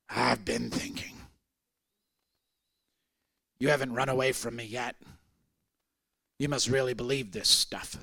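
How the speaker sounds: put-on a frail old patient confides in his caretaker, softly; soft, weak old man. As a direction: speak like a dying old man musing. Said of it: "He goes".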